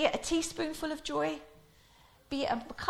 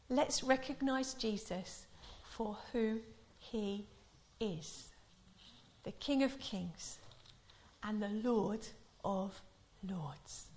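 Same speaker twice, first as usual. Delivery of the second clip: 1 solemn preaching; 3 semitones lower, 6 dB quieter, slower than usual. Real, not acted. Solemn closing exhortation.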